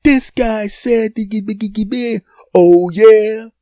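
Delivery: very loud, high shouted confrontation; huge angry boyfriend. Retold or imitated. Huge boyfriend storms in on the sandwich-shop row, shouting. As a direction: burst in bellowing, high and indignant. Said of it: "huge boyfriend comes in the room".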